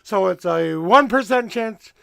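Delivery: normal talking voice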